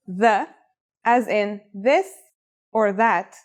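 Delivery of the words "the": the with TH as in "this"; voiced TH. Shown as a demonstration. Teacher showing the new letter's sound, the with voiced TH. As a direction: say the with a buzzing, voiced TH. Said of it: "is a voiced sound".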